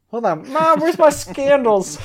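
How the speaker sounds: high yell to Mom; yelling across the room. Pretend. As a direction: call out loud like a whiny kid. Kid yelling to mom for sandals.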